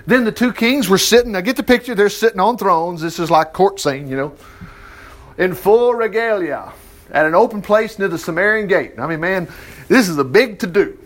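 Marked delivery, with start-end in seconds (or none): none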